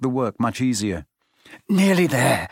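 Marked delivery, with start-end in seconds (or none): 1.7-2.5 panting. A narrator's reading. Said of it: "Harry panted".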